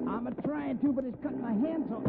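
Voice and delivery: high, pained effort on the rope; straining. Acted. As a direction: strain against the pulling rope.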